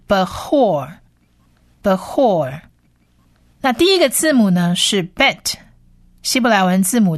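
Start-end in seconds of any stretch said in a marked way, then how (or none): 0.1-2.6 Behor said slowly as a model, twice with a pause; Behor pronounced slowly. Shown as a demonstration. Teacher models Behor for listeners learning Hebrew, slow pronunciation demo. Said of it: "我们念成是".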